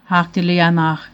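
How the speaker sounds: ordinary delivery, nothing marked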